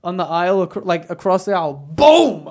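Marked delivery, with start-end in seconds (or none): 1.7-2.5 loud, drawn-out boom; booming impact shout. Retold or imitated. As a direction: imitate the slam with a big shout. Sound effect of the slam.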